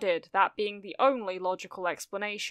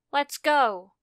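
higher, louder rallying call; urging onward; 5 semitones higher, 5 dB louder than usual. A narrator's reading. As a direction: get your partner moving. Ochako urges Jiro onward.

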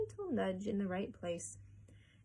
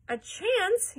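loud, high outraged question; yelled; 12 semitones higher, 8 dB louder than usual. A narrator's reading. Mother reacting to the owner's reassurance, outraged yell. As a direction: yell it back in disbelief. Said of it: "yelled Missus Salt".

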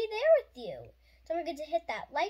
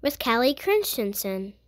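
put-on lower, slower read-aloud voice for a children's book; gentle storybook reading; 6 semitones lower, 8 dB louder, slower than usual. Host reading a grief picture book to young viewers, gentle and unhurried.

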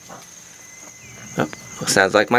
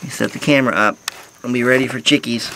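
ordinary delivery

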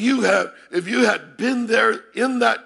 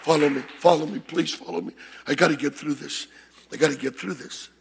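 lower, softer urgent plea; low, pleading aside; 5 semitones lower, 5 dB quieter, faster than usual. Real, not acted. Preacher begs congregation to follow along, low pleading aside. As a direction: drop the voice and plead for attention.

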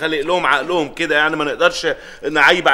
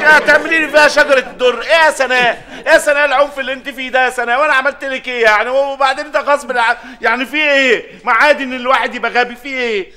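high-pitched outrage; 9 semitones higher than usual. Acted. Brother protests his sister's violent plan in high-pitched outrage. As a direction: squeal it out like a scandalised brother.

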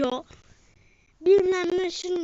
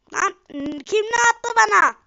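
loud, higher angry demand; angry shout; 6 semitones higher, 6 dB louder than usual. Real, not acted. Gamer shouting at an unseen thrower, angry. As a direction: yell at whoever threw it.

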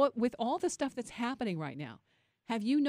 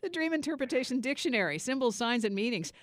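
high, louder radio plug; announcer read; 5 semitones higher, 4 dB louder than usual. Acted. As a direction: sell the book title. Host reads book title.

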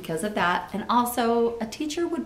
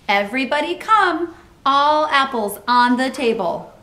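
loud, raised call to a group; 5 semitones higher, 6 dB louder than usual. A narrator's reading. Amelia Bedelia summoning children from the yard, loud carrying call. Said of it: "Later she called".

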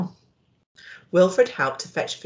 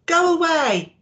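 upset go away; 11 semitones higher, 6 dB louder than usual. Acted. Boy hiding under bed.

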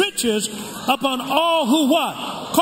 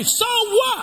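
defiant shout; 10 semitones higher than usual. Real, not acted. Pastor daring critics before the congregation, high defiant shout. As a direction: fling it out, daring anyone to object.

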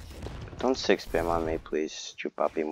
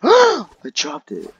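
a gasp, then a much higher, louder, slower I dropped it; shocked gasp; 12 semitones higher, 12 dB louder, slower than usual. Real, not acted. Gamer gasps out loud on losing the item, dismayed. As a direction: cry out in real dismay.

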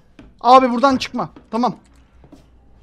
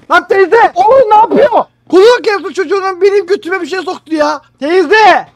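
loud, high panicked yells; yelling at auntie; 7 semitones higher, 11 dB louder than usual. Real, not acted. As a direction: shout her down in a panic. Yelling at attacking aunt.

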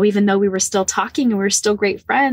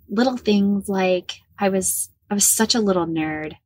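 ordinary delivery, nothing marked

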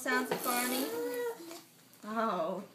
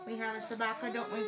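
usual speaking voice

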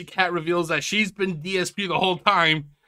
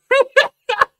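squealing laugh; high helpless laugh; 9 dB louder than usual. Real, not acted. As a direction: break into a high wheezing laugh. Cracking up at picture.

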